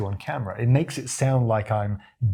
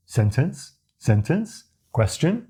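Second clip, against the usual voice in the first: each word rising higher like a question; rising endings; 4 semitones higher, slower than usual. Shown as a demonstration. Coach showing questioning upward endings. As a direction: lift the end of "sentence", "sentence", "question" upward. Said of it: "ask a question at the end of a sentence".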